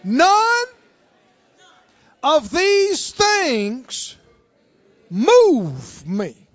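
slow, loud, drawn-out declaration with a high start; faith talk. Acted. Preacher shows how faith talks, slow and loud. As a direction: stretch each word out in firm, defiant faith. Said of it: "How does faith".

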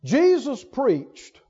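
ordinary talk

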